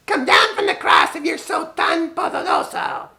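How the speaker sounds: high, harsh jeering shout; mocking crowd. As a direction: sneer up at the cross like a scoffer. Mockers at cross quoted.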